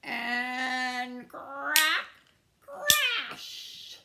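loud, high sound-effect words; dramatic crash. A narrator's reading. Narrator tells of Squirrel's house breaking, loud dramatic burst. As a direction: stretch "and", then explode on the crash.

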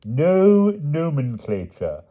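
high, slow solemn pronouncement; solemnly. A narrator's reading. Palomides solemnly backing Pellinore's discretion, slow. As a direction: intone it with grave weight. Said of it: "said Sir Palomides solemnly".

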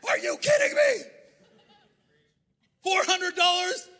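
high, loud, slow mock-outraged shout; outraged spouse. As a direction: shriek at the spending like a shocked spouse. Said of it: "your spouse is gonna be like".

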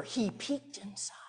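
softly, almost a whisper; hushed. Acted. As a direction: whisper it like peeking in. Quiet peek into the tomb.

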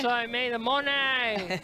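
slow, drawn-out mock holler; playful catchphrase call. Commentator riffing on player Eddie Monet's surname, playful drawn-out call. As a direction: stretch out the catchphrase like a crowd call.

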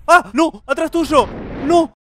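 high, loud panicked cries; frightened screams. Real, not acted. Gamer spotting a threat behind his character, screaming in fright. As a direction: yelp it out like something's right behind you.

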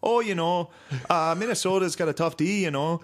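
singsong mock Minnesota accent. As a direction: imitate a folksy Minnesota ice fisherman. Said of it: "they found an ice fisherman".

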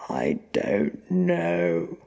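very slow, loud, strained answer; anguished captive, dragging words; desperate denial. A narrator's reading. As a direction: force out a helpless denial. Frightened captive pressed for answers.

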